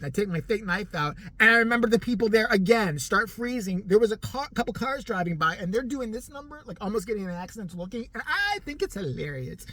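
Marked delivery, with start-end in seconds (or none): none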